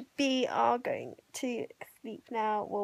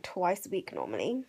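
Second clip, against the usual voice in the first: usual speaking voice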